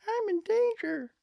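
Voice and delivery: very high, slow silly voice; meme quote voice. Pretend. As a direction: squeak it out like a cartoon.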